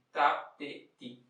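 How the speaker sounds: "Tá, te, ti" spaced and even; rhythm syllables. Shown as a demonstration. Teacher counting out the subdivision syllables. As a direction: say "Tá", "Te", "ti" as even beats. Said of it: "figuras da subdivisão puras".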